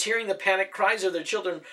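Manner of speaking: ordinary delivery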